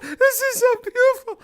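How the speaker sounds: high, gushing awestruck voice; mock-awestruck, high and gushing; mock awe. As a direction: gush like you're overwhelmed. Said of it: "and we're all like".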